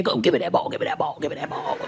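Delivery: put-on low, fading toddler demand; grandbaby mimic. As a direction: mumble it like a toddler. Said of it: "They be in there".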